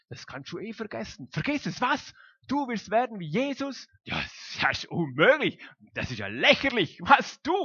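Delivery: high, loud mocking tempter; mocking devil talking them out of trying; the evil one's voice. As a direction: scoff at the idea of being like Jesus. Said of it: "das Böse wird uns auch einflüstern".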